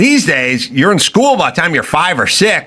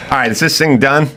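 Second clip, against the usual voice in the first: normal talking voice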